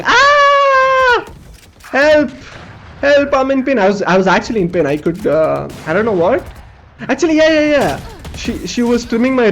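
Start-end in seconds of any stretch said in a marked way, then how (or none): 0.0-3.8 screaming for help. Retold or imitated. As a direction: yell out for help, hurting. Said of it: "I'm screaming".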